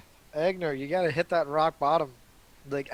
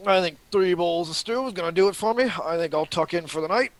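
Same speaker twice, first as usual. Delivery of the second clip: raised, lively dwarf voice; dwarf character voice; 5 semitones higher, 6 dB louder, faster than usual. Acted. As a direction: announce bedtime as a hearty dwarf. [name] stretches, turns in for night.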